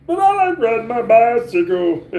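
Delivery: a hint of a giddy kid-like comedian; Pee Wee Herman voice. Streamer doing a requested impression for chat, light goofy voice. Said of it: "Do your best Pee Wee Herman impression".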